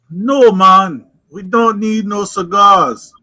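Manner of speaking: put-on Jamaican accent. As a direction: lay on a Jamaican accent, stretching the words. Posing as a Jamaican.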